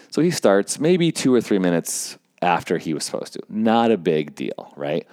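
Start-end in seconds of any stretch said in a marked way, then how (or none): none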